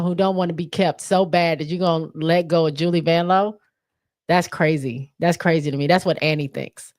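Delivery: ordinary delivery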